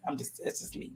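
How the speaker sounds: whispered, half to herself; whispered aside. Real, not acted. Quiet aside after accent joke.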